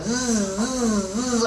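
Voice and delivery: long vocal zzz of a bee; buzzing bee. Retold or imitated. Bee interrupts man praying, imitated buzzing. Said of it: "this fucking bee just starts".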